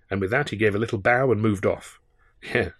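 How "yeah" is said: low, soft mutter to himself; a quiet sour aside to himself. Acted. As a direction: grumble it under your breath. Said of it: "I muttered to myself".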